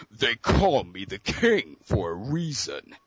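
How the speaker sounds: slow, low gloating villain boast; deep Sagat voice. Retold or imitated. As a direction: savor it like a fighting-game king.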